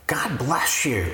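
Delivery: ordinary talk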